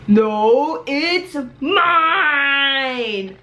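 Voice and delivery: tearful high whine; whiny crying. Acted. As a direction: cry it like a kid losing a toy. Character cries over object.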